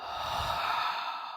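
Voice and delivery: long slow sigh out; audible breathing. Shown as a demonstration. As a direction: breathe out audibly through the mouth. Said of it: "Let's take one reset breath".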